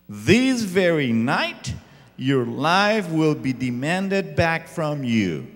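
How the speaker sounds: loud, high, slow scolding; God scolding the rich fool loudly; stern rebuke. Acted. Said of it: "Jesus says in verse twenty".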